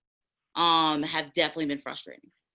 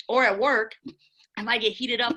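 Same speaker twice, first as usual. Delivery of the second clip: heated indignation; 7 semitones higher than usual. Real, not acted. Defending her tone, getting heated.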